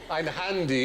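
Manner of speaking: hammed-up camp German accent. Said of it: "your sort of camp German accent".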